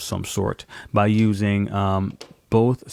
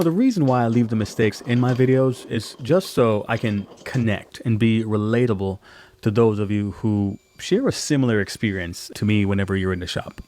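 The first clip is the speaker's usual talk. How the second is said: usual speaking voice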